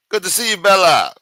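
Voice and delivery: light up for her; excited greeting. Real, not acted. Streamer greeting viewer Bella, excited and loud.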